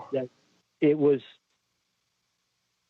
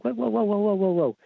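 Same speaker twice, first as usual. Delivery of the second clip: retold alarmed interruption; 7 semitones higher, faster than usual. Interrupting the funeral man, retold.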